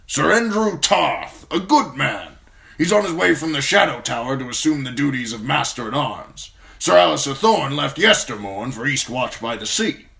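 put-on a gruff old commander praising a young man; gruff old lord commander. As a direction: speak as the stern old Lord Commander, grateful but brusque. Mormont voice.

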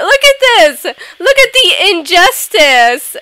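loud, high cry of protest; hammed-up wail of injustice; mock outrage. Player protesting to everyone about rejection, loud mock outrage.

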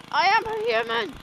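high, loud insistent yell; loud indignant declaration; shouted. Shown as a demonstration. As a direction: shout it out in protest.

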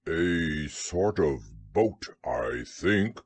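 low, slow bear voice; deep and slow, thinking it out; unsure Pooh. A narrator's reading. Bear guesses for Rabbit, low and slow.

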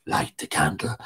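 low, soft and hollow; corpse voice. A narrator's reading. Corpse ordering Tig in the dark, low and hollow. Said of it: "said the corpse".

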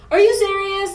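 high, loud cat voice asking; mock cat scolding. Cat scolding the yelling mom, high and indignant. Cat's voice in family fight.

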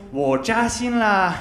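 我扎心了 said slowly, stressing 扎; slow careful zhaxin. Shown as a demonstration. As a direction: pronounce 扎心 slowly and clearly. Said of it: "说".

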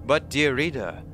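measured, theatrical narration; dramatic article reading. Acted. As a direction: read it like a mysterious tale, leaning on key words. Reads an in-game article aloud.

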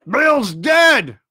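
loud, high shout of surprise. A narrator's reading. Man in the house tells those outside, shouting. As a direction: call the news out from indoors. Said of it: "a voice shouting from the house".

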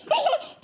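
sudden high laugh at her own words; burst of laughter. Real, not acted. Laughing as she takes back her praise. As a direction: laugh out at the sting. Realises the product burns.

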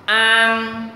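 "An" loud, high and held long; open-mouthed, throaty AN. Shown as a demonstration. Teacher models "An" for learners, mouth wide open. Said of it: "Đọc phát âm từ cổ họng".

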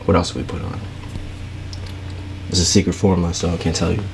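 normal talking voice

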